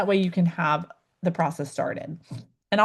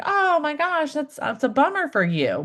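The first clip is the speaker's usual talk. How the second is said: much higher, gushing pity from a distance; syrupy mock sympathy; 8 semitones higher than usual. Shown as a demonstration. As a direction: coo over their bad luck without really feeling it. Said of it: "Sympathy is sort of like being like".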